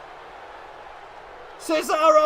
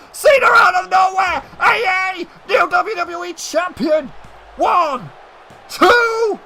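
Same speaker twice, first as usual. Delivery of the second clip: loud, higher play-by-play yell as the finisher lands; excited shout; 6 semitones higher, 4 dB louder than usual. Real, not acted. Commentator yelling to viewers as Cena hits the move, excited shout. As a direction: call it like the crowd just erupted.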